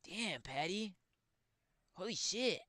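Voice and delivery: high, slow, drawn-out disbelief voice; MMA Guru impression. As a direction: stretch out Damn, Patty in a high whine. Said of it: "I can do a very mediocre version of them".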